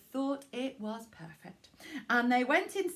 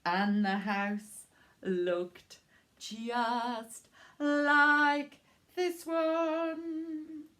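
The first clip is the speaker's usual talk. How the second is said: slow reveal; 4 semitones higher, much slower than usual. Acted.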